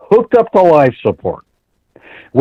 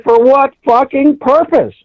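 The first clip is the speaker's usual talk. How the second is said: mock angry demand; 12 semitones higher than usual. Demanding answers from officials.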